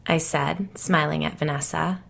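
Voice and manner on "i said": plain reading voice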